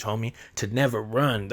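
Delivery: usual speaking voice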